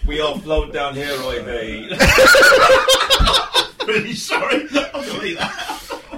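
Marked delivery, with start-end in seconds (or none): 1.9-3.8 high, loud burst of laughter; helpless laugh. Real, not acted. Host losing it mid-recording, helpless laughter. 3.9-6.2 apology wheezed out through laughter, higher; laughing apology. Real, not acted. Host apologising to the group, laughing breathlessly.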